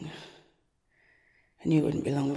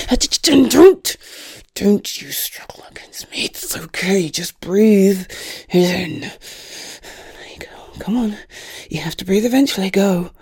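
forceful urging; 3 semitones higher, 12 dB louder than usual. Acted. Forcing victim to inhale drug.

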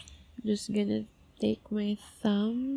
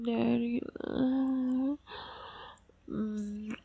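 normal talking voice

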